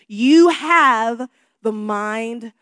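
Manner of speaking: preacher hammering the point; emphatic preaching. Real, not acted. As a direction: drive it home to the congregation. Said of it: "I'm trying to get your attention".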